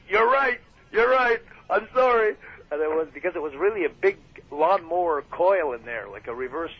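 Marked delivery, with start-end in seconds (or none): 0.1-2.4 high, loud, nervous pleading sorry; sheepish high apology. Retold or imitated. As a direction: beg forgiveness, pitched up. Apologising to angry wrestler.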